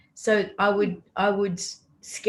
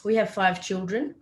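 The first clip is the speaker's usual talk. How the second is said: ordinary talk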